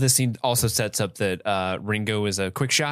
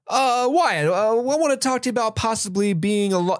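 eager official; 9 semitones higher than usual. Retold or imitated. Voicing eager lawman.

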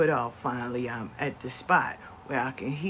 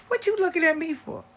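much higher and louder, indignant; mother snapping back; 13 semitones higher, 5 dB louder, faster than usual. Retold or imitated. Mother to glaring son, high and indignant. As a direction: throw the question back at him. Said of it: "she said".

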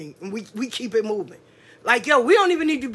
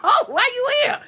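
mock surprised; 10 semitones higher than usual. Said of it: "I'm like".

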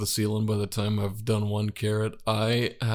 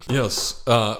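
usual speaking voice

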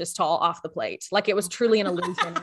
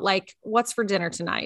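usual speaking voice

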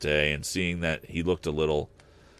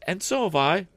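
put-on much higher child's exclamation; exclaimed; 13 semitones higher, 4 dB louder than usual. Another child joining in, high exclamation. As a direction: pipe up like a small child. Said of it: "exclaimed another".

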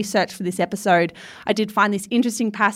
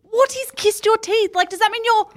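genuinely confused, pitched way up; baffled; 13 semitones higher than usual. Retold or imitated.

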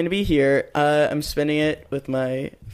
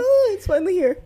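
very high, giddy squeal; squeaky excited voice; 7 dB louder than usual. Pretend. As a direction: shriek it like a thrilled kid. Mock excited holiday voice.

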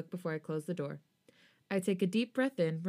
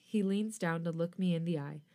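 ordinary delivery, nothing marked

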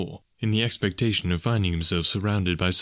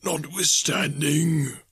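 high, slow spectral voice; ghost; 8 semitones higher, much slower than usual. A narrator's reading. Ghost correcting Scrooge, slow eerie insistence. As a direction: intone it slowly as a phantom.

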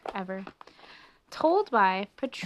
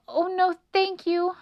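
high, loud, hurried-to-leave refusal; anxious little mouse; nervous refusal; 10 semitones higher, 6 dB louder than usual. A narrator's reading. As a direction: decline politely but in alarm. Frightened mouse declines, then flees.

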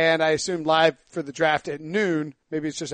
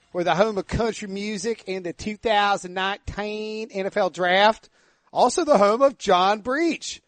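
ordinary talk, nothing marked

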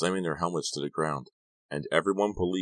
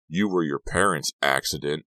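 plain reading voice

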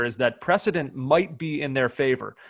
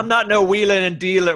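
louder, higher put-on denial as a football manager; Harry Redknapp impression; 5 semitones higher, 5 dB louder than usual. Joking on the podcast about Moyes, a louder, higher mock Redknapp denial. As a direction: brush off the label, playing Harry. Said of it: "Oh, that was Redknapp, right?".

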